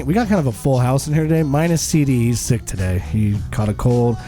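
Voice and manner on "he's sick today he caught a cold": normal talking voice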